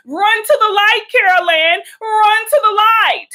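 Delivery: high, drawn-out spooky call into the light; mock movie call. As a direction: beckon like the ghostly voice in the film. Mock Poltergeist call to Carol Ann.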